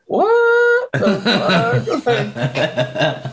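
drawn-out, laughing high what the fuck; slow, laughing shock. Retold or imitated. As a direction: stretch it out in laughing disbelief. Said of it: "I'm like".

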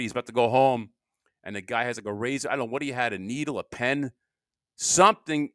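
normal talking voice

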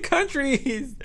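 high, giggly "countries"; laughing. Real, not acted. Laughing at her own slip to viewers, giggly.

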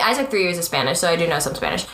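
ordinary talk

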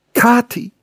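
high, loud cry of a name; sudden high-pitched call. A narrator's reading. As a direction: call out to the old servant. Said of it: "Die jungen Lippen hatten es gerufen".

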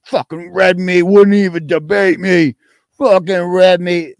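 mocking Brett. Streamer mocks rival Brett as a sulking loser, slow put-on rant. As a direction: sneer it out as the sore loser. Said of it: "He's gonna be so".

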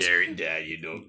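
low, softening Nottinghamshire accent. Shown as a demonstration. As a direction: drop it low in Nottinghamshire dialect. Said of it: "in your Nottinghamshire accent".

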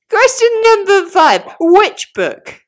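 loud, high game show host; loud announcer. Pretend. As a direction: boom it out like a quiz host.

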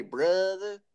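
teasing imitation of an exaggerated word; mocking echo. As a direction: parrot the odd pronunciation back in disbelief. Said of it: "You gotta say it like that?".

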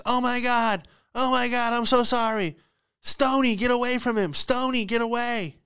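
high, strained mock calling-out; distant panicked owner. Dog owner calling off the dog attacking a stranger, high mock panic. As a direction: imitate a frantic dog owner from afar. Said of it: "Then I hear in the distance".

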